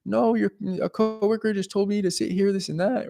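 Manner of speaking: imitating a flustered girl in a high voice; high, soft voice. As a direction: put on a high, soft, hesitant girl's voice. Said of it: "giving me the high, soft voice".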